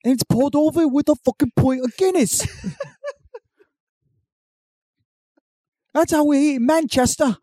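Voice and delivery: high, sneering Cockney rant; mock Cockney accent. Parody British contestant cursing Thatcher, mock Cockney accent. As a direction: spit insults like a drunk Brit. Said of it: "Cockney accent".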